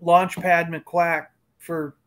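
ordinary talk

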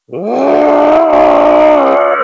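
low, loud groaning "Ah"; frustrated groan. Retold or imitated. Retelling his frustration to his cohost, a low loud groan.

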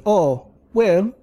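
lightly put-on European accent for the kid. Kid answering the singer's question, light European accent. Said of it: "I'm gonna drop the accent for him".

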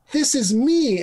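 high, pleased child's voice, lightly played; proud little girl. Girl showing her comic character, proud high child voice. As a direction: announce it like a proud kid.

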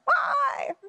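high squawked crow imitation; crow cawing. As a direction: caw the word like backyard crows. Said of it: "like the crows in my backyard".

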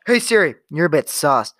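calling Siri out loud and clear. Acted. Teasing Siri loudly, calling her sus. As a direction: say it loud so the phone hears.

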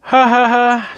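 high monotone ha-has; doleful fake laugh. A narrator's reading. Little Miss Giggles tries to giggle and fails, dolefully. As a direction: force out a joyless laugh. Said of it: "she said dolefully".

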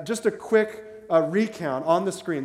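ordinary talk, nothing marked

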